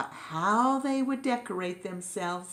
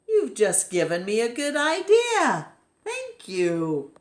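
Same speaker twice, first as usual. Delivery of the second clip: delighted idea; 6 semitones higher, 5 dB louder than usual. Acted. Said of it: "he said to himself".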